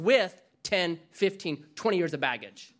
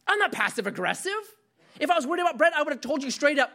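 Jesus protesting; 9 semitones higher, faster than usual. Pretend. Pastor voicing Jesus denying he is passive aggressive, indignant. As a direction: voice Jesus as baffled and affronted. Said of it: "So he's like".

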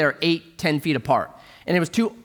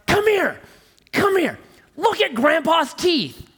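put-on child calling; 13 semitones higher, slower than usual. Big sister calling her little brother over, high and eager. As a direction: summon him excitedly.

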